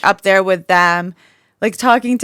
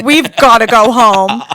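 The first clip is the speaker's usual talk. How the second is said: tearful friend; 3 semitones higher, 7 dB louder than usual. Retold or imitated. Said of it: "comes back in tears".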